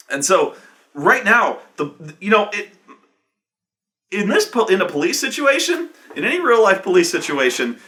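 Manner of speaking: usual speaking voice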